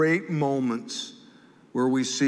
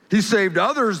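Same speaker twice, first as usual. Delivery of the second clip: loud, higher sneering voice; scoffing; 6 semitones higher, 7 dB louder than usual. Acted. Priests scoffing at Jesus on the cross, loud sneer. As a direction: sneer it out with contempt. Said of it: "they scoffed".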